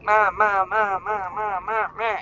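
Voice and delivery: insistent repeated calls, starting loud; imitating pestering child. Three-year-old coming up to mom during homework, imitated pestering. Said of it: "asking me the same thing over and over".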